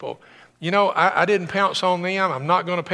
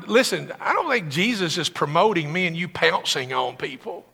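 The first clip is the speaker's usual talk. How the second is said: usual speaking voice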